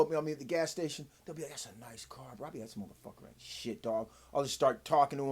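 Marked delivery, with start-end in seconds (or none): 1.5-2.5 quiet mock stranger. Voicing stranger at gas station. 2.7-4.1 hushed, breathy confiding whisper; softer whispered aside about the car; whispered reply. Retold or imitated. His reply to the stranger.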